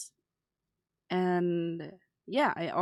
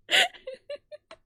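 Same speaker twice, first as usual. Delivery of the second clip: high squeal laugh; 3 dB louder than usual. Real, not acted. Sees capture card update.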